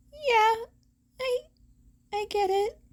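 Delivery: a hint of a nervous boy confessing; young hero. A narrator's reading.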